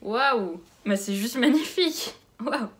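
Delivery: loud, wowed reaction; stunned delight, belted out; amazed. Real, not acted. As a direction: let out real, loud amazement at a gift. Amazed on unwrapping a gift.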